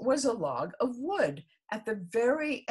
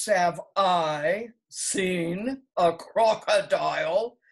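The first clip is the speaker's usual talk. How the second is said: slow, loud, scornful snake voice; dreadful scorn; 8 dB louder, much slower than usual. A narrator's reading. The rock snake scorns the elephant's child, slow and loud. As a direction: sneer each word slowly. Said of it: "in a voice of dreadful scorn".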